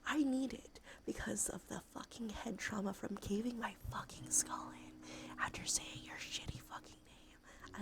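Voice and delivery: ordinary delivery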